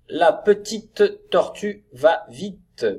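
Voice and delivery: slow, loud, word-by-word teacher reading; sentence reading. Shown as a demonstration. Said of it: "Ensuite, des phrases".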